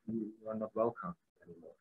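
usual speaking voice

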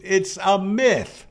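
ordinary delivery

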